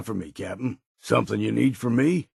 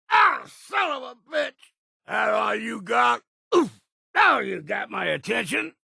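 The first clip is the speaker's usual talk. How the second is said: angry battle shout; 11 semitones higher than usual. Acted. Companion struck by an enemy, shouting back in anger. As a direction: yell it after taking a hit. Companion hit during a fight.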